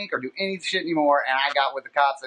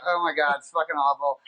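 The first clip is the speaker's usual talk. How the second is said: usual speaking voice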